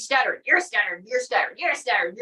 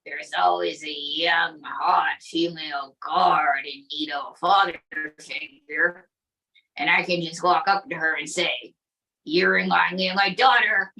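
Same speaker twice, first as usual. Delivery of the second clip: sleazy Trump puppet; 6 semitones lower than usual. Acted.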